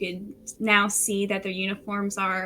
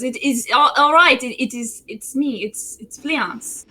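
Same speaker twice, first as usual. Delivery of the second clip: cheerful prince; 3 semitones higher, 6 dB louder than usual. Acted. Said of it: "He goes".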